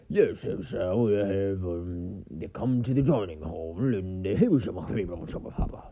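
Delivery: mock British period-drama accent, words smeared into gibberish; Ebenezer Scrooge accent. Comedian mimicking Downton Abbey dialogue, unintelligible mock British accent. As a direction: mumble through a hammed-up stuffy British accent. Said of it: "one of those Ebenezer Scrooge accents".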